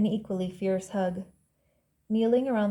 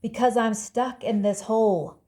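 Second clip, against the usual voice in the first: louder, exasperated narrated shout; 2 semitones higher, 6 dB louder than usual. A narrator's reading. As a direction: shout it out, stressing each beat. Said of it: "but Hal shouted".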